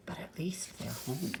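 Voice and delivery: voiceless hushed aside; whisper. Real, not acted. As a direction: whisper it to the listeners.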